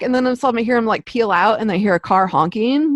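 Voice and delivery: usual speaking voice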